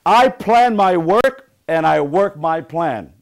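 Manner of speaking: loud, slow motivational chant; emphatic affirmation. Shown as a demonstration. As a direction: drive each word home for the group. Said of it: "Let's step it up a notch".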